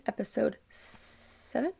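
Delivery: unsure whisper, trailing up; half-whisper, unsure of the number; whispered question. Real, not acted. Vlogger checking the episode, a hesitant whisper.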